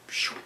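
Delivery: soft "pshoo" whoosh; whooshing paper. Retold or imitated. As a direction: imitate paper flying off with a quick airy hiss. Imitates paper flying away.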